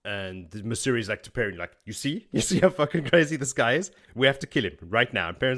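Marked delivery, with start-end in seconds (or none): 1.8-5.3 retold agitated plea, pitched up; agitated urging. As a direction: urge it insistently, acting out an alarmed companion. Acts out Masuri urging Perrin to kill.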